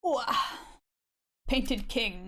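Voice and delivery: ordinary delivery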